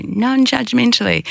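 lifts the word up high in a mock preachy voice; mocking pious tone. Mocking those who preach non-judgment, high-pitched mock tone. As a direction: parodies people who say it smugly. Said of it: "when people go".

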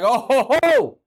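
loud, high, gloating ho ho; mock pundit gloat. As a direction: ham up a smug pundit springing a surprise. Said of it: "He was like".

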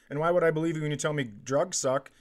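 normal talking voice

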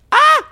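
high loud squeal of delight; excited scream. Retold or imitated. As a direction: let out a thrilled shriek. Screaming over the drag show.

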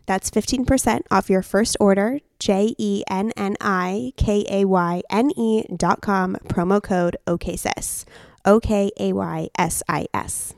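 loud, measured promo read. Acted. Reads a sponsor ad.

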